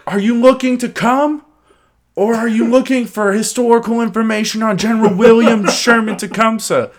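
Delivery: high-pitched mock-British questioner; loud, high comic British voice; put-on British accent. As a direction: play a very proper British inquirer, pitched well up. Voicing the accented dialogue bit.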